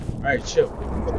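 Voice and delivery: hushed whisper to the squad. Real, not acted. Gamer hushing his squad mid-match, whispering. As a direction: whisper it so the enemy can't hear. Hushing teammates during tense fight.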